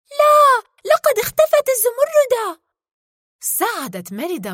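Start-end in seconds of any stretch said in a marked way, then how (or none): 0.1-2.6 high, loud cry of alarm; fearful scream. Acted. Merida sees the emerald vanish, screaming in fear. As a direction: scream out in fright, pitch shooting up. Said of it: "صرخت ميريدا بخوف".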